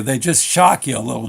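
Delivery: ordinary talk, nothing marked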